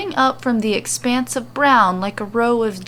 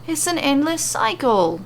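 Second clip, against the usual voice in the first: put-on Australian accent; 5 semitones higher than usual. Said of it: "they will probably end up sounding Australian".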